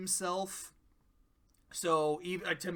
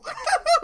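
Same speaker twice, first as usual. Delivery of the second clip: loud laugh; 12 dB louder than usual. Real, not acted. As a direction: burst of loud high laughter. Bursts out laughing.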